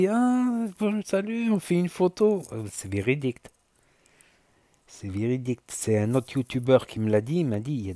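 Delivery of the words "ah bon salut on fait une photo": high, sugary mock greeting; fake-friendly hater. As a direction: play the hater suddenly all sweet and polite. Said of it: "quand ils te voient". (faux ami tout mielleux, voix haute)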